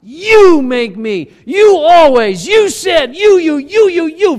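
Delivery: high, loud shouted accusations; accusing shout. Retold or imitated. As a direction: hammer each you like a flaming arrow. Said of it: "an arrow with a flame".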